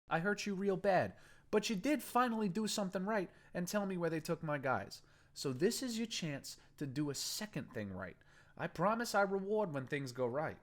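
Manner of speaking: persuasive gang boss. Acted. Boston persuading the captive to leave his boss, coaxing. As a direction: sell him on switching sides.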